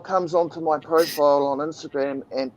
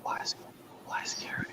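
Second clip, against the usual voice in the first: whispering; 18 dB quieter, faster than usual. Real, not acted. Whispering question.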